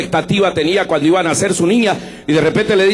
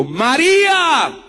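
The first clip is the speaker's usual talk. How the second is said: high, slow, drawn-out calling of María; Jesus calling his follower by name, slow and high; gentle call of a name; 9 semitones higher, much slower than usual. Acted. Let María ring out slowly, lightly acted.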